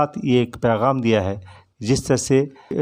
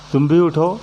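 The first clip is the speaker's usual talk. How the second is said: raised, higher call to get up; calling out to wake; 5 semitones higher, 4 dB louder than usual. Acted. Morning calls sleepers to wake, a raised high call. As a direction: urge the sleepers up, pitched high and loud.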